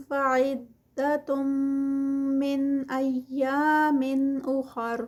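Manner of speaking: slow, drawn-out tajweed recitation; Quranic recitation. Shown as a demonstration. Teacher reciting the verse for students, slow and melodic. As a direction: chant each word slowly, stretching the vowels.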